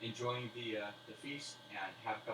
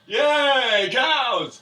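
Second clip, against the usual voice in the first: much higher, much louder whoop of yay cows; drunken cheer; 21 dB louder than usual. Acted. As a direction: cheer like a clueless drunk crowd. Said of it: "half of them go".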